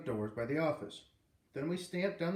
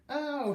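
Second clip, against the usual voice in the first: high, loud cry of pain; pained scream; 13 semitones higher, 4 dB louder than usual. Acted. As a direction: scream as if hit. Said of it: "she screamed".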